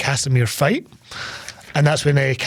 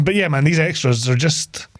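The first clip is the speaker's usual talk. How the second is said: ordinary talk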